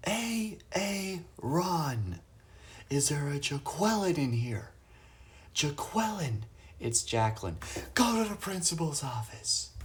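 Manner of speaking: high-pitched sketch-comedy teacher impression; Key and Peele. Streamer quoting a favourite comedy sketch, high-pitched impression. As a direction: call the names out high and drawn, mangling them. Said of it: "I love Key and Peele".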